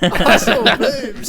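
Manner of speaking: high, slow hillbilly echo while laughing; hillbilly accent. Retold or imitated. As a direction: copy the hillbilly line slowly, laughing. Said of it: "You sounded like a hillbilly".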